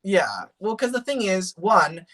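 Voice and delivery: ordinary delivery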